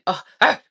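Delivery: short, loud, high cry of craving; exasperated high yelp; frustrated squeal. Real, not acted. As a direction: let out a sudden loud "ah". Frustrated craving, mouth watering.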